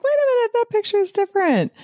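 high, quick double-take; mock surprise. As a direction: play a listener who just noticed the new picture. Imagined listener noticing change.

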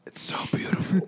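soft unvoiced whisper of awe; whispered as if overwhelmed; awed whisper. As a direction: hush it in wonder. Awed reaction to gift.

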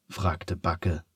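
ordinary delivery

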